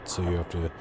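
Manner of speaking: ordinary delivery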